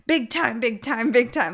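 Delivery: excitable mock holler, repeated insistently; imitating Chad. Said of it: "Chad starts screaming".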